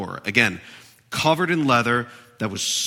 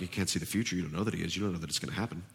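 much softer, lower sneering murmur; taunting Satan; 6 semitones lower, 12 dB quieter, much faster than usual. Retold or imitated. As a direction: needle them quietly with doubt. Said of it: "Satan could be like".

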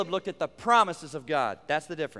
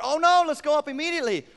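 higher, fast, eager voice; eager Caleb; 8 semitones higher, 4 dB louder, faster than usual. Retold or imitated. Caleb urging Israel to go up at once, eager. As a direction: play Caleb keen to go. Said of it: "as he gave his report".

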